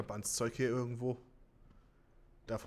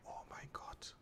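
breathless whisper; 18 dB quieter, slower than usual. Real, not acted. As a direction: whisper it in awe. Unvoiced whisper.

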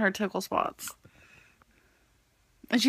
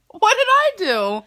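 laughing, high and loud; 11 semitones higher, 6 dB louder than usual. Real, not acted. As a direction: squeal it while giggling. Laughing protest.

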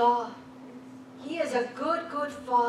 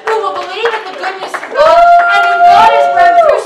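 high, very loud proclamation; fervent shouting preacher; preaching shout; 7 semitones higher, 17 dB louder than usual. Real, not acted. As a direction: declare it at full force. Preaching at full force.

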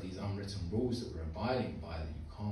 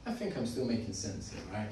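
usual speaking voice

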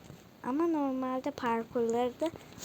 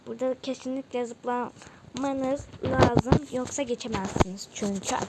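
ordinary delivery

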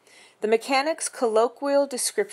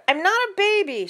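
put-on much higher, louder protesting child; whiny little sister; 13 semitones higher, 6 dB louder than usual. A narrator's reading. Emily protesting to her brother, high whiny voice. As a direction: whine it back like an offended little girl.

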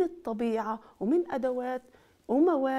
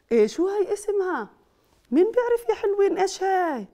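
put-on higher, louder quiz to kids; excited question; 8 semitones higher, 6 dB louder than usual. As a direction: ask it like a thrilling riddle. Quizzing children.

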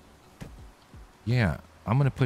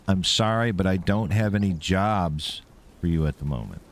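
proper English demo. Shown as a demonstration. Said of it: "That's proper English".

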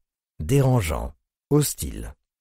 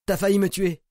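shouting; 6 semitones higher, 3 dB louder, faster than usual. Acted. Victim yells after electric shock.

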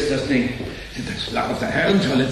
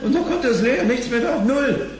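much higher, rising alarm with a nervous laugh; shocked outcry; 13 semitones higher than usual. Acted. Reginald reads the zero balance aloud, high and alarmed. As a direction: voice jumps up in disbelief.

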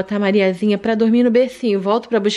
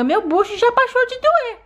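put-on high childlike voice of a small daughter; sweet little daughter asking mommy for more; little girl voice; 10 semitones higher than usual. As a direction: play the sick little girl talking to mom. Doll insists.

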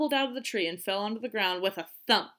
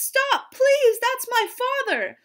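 very high, loud plea; girl crying out in alarm; desperate cry; 8 dB louder, slower than usual. A narrator's reading. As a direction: beg them frantically. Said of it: "cried the princess".

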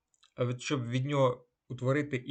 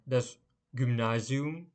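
"das Gymnasium" drawn out syllable by syllable, rising at the end; slow teacher pronunciation of "das Gymnasium"; slow careful model; slower than usual. Shown as a demonstration. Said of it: "teacher repeats word slowly then normally".

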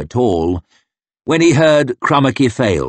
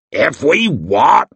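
growl; 6 semitones higher, 4 dB louder, slower than usual. A narrator's reading. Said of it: "growled Crummock".

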